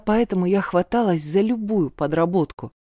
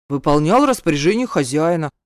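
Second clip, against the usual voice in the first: lightly voiced male guard, matter-of-fact; businesslike guard; 3 semitones lower, 4 dB louder than usual. A narrator's reading. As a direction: answer as a man on duty. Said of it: "деловито добавил". (деловитый охранник)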